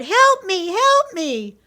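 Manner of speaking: put-on high, loud child's plea for help; frightened cry. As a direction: cry out like a terrified boy. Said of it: "the little boy cried".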